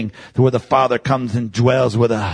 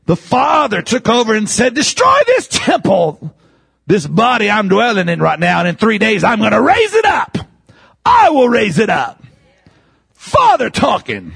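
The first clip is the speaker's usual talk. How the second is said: raised, declaiming preacher voice; full-force preaching; 7 semitones higher than usual. Real, not acted. Preacher voicing the Father's promise, proclaimed high. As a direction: build to a shouted call for amen.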